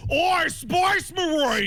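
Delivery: high, slow Cockney yell like a charging orc; mock Cockney ork war cry; Cockney orc shout. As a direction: bellow it in a hammy Cockney orc voice. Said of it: "a orc and a Cockney accent going".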